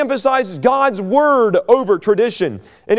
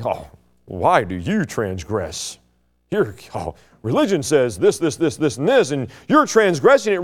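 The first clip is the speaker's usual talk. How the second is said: mock accusing Pharisee; 5 semitones lower than usual. Acting out religion intimidating.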